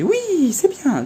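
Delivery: very high, sing-song "oui, c'est bien"; praising the dog. Retold or imitated. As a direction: reward him with a high, bright voice. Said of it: "je le félicitais".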